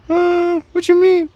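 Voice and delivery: put-on high, loud falsetto girl; girl's voice. Girl's reply in acted pickup.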